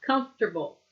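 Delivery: "Comfortable" modelled as KUMF-ter-bul; teacher's model "Comfortable", middle sounds merged; squished together. Shown as a demonstration. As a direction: say "Comfortable" in three joined syllables. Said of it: "One more time".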